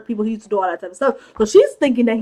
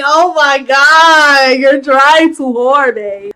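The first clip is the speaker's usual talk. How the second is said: mock cringing reaction; 2 semitones higher, 5 dB louder, much slower than usual. Real, not acted. Speaker reacts to awkward sexy eating.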